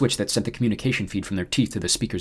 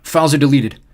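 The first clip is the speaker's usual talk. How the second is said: gasping; 6 semitones higher, 9 dB louder than usual. A narrator's reading. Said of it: "Johnny gasped".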